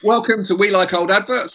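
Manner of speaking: upbeat show host welcome; bright channel opener; presenter intro. Acted. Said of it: "channel host intro".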